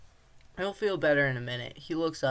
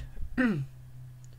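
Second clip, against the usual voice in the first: a quick dry clearing of the throat; 5 semitones higher than usual. Real, not acted. Narrator pausing to clear the throat. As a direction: clear the throat before going on.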